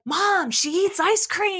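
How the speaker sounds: put-on high, drawn-out delighted child voice; imitated child's gleeful announcement; excited little girl. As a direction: call out to mom, thrilled. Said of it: "walks away and she's like".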